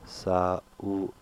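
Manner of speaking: Sa-u-n-ded broken into its sounds; sounded split up. Shown as a demonstration. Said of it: "W is pronounced like a light U".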